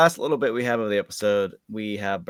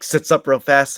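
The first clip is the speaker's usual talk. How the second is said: ordinary talk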